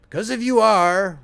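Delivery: put-on a fretful old man pressing his point; querulous old man. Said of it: "went on the old man querulously".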